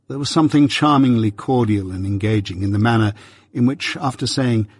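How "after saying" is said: ordinary delivery, nothing marked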